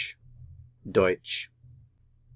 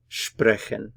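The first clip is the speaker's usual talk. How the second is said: usual speaking voice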